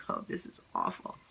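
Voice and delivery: breathless whispered dismay; barely voiced, stunned under the breath; a shocked little whisper to herself. Dismayed aside after being attacked.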